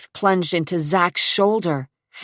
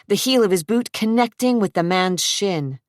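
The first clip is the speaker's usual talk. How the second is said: ordinary delivery, nothing marked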